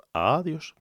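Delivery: ordinary talk, nothing marked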